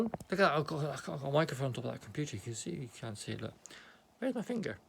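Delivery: ordinary talk, nothing marked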